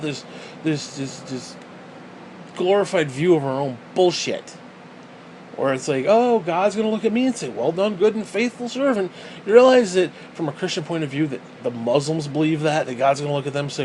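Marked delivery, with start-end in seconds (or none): 6.1-9.1 higher-pitched imitation of self-satisfaction; mock smug believer. Man mocking believers expecting God's approval, mock smug. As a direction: lightly parody a believer sure of praise.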